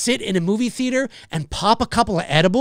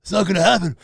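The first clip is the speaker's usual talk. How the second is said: lightly voiced stoner, lower; 6 semitones lower, faster than usual. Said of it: "Stop stereotyping me with your stoner voice".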